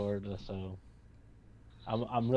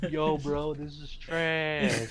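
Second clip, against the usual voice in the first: higher, drawn-out mock complaint; mock disgusted friend; 5 semitones higher, much slower than usual. Friend messaging about the Flash's run, mock disgusted. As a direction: imitate a friend trashing it, stretching the last word. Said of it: "I know Kev, he'll, like, message me".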